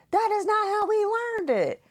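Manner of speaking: put-on high, whiny kid insisting; mother imitating her daughter's high indignant complaint; imitating indignant child. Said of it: "being like".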